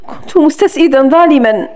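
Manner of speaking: ordinary delivery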